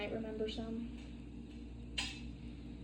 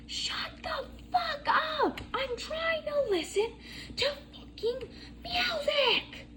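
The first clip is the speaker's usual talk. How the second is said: high, squeaky mock yell of an angry kid; mock kid's scream; slower than usual. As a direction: screech it like a bratty kid. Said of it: "started screaming at me".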